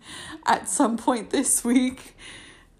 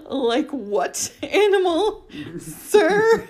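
high, giggling question; laughing; 8 semitones higher, 3 dB louder, slower than usual. Real, not acted. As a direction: ask through giggles.

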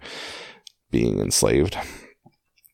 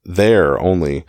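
"there" slow and emphasized; stress on THERE; 4 dB louder, slower than usual. Shown as a demonstration. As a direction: land firmly on "there", stretching the phrase. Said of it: "I kinda tried to emphasize it".

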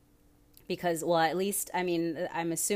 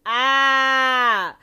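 laughing; 8 semitones higher, 12 dB louder, much slower than usual. Real, not acted. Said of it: "I have not stopped doing that accent".

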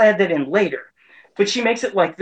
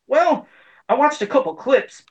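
lightly voiced, higher, offhand actor; mocking the voice actor; 7 semitones higher than usual. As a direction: toss off the answer in a higher pitch. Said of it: "And he's like".

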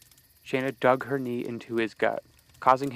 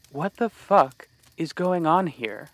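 slow, higher, louder furious demand; angry demand; 5 semitones higher, 5 dB louder, slower than usual. Acted. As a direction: force the answer out of him. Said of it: "Shayna demanded".

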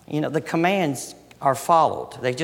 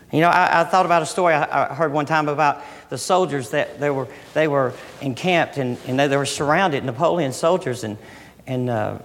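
ordinary talk

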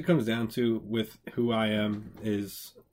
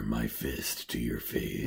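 deep, slow movie trailer announcer; mock trailer voice announcing a fight; 11 semitones lower, 4 dB quieter, much slower than usual. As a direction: lower and drag it like a trailer.

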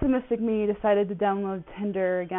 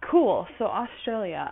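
normal talking voice

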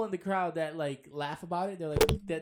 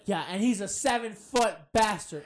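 louder, higher imitation of a hype man; retold rowdy announcement; 4 semitones higher, 8 dB louder than usual. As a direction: shout it out like announcing a wrestler. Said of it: "And he's like".

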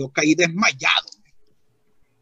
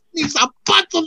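very high comic wail; alarmed outcry; 14 semitones higher than usual. Retold or imitated. Cousin's victim in church lamenting shoes, alarmed outcry. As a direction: cry out in mock panic, pitch way up.

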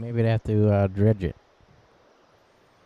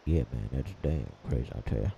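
much lower gruff voice; deep gruff voice; 11 dB quieter, much faster than usual. Acted. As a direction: drop way down and growl. Low gruff voice bit.

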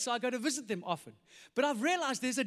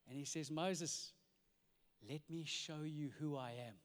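much softer, lower reverent preaching; hushed worship; 7 semitones lower, 10 dB quieter than usual. Acted. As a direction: draw them into awe quietly.

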